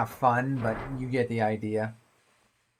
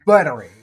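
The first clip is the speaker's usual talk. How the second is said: louder, weighty "battery"; great emphasis; 12 dB louder than usual. Shown as a demonstration. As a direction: punch "battery" with mock gravity. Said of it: "saying it like that".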